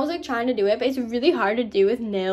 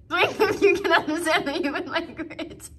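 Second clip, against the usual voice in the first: playful pet-talk; 6 semitones higher than usual. Pretend. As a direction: repeat it goofily to a pet, near laughing.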